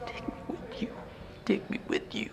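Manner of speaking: a whisper, almost no sound; silent lip-read plea. Retold or imitated. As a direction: mouth it like a boy behind a window. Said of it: "I could read his lips. And he's saying".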